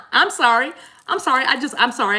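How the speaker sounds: loud, high, laughing apology. Real, not acted. Host apologising to viewers after a flashback, laughing. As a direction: apologise while cracking up.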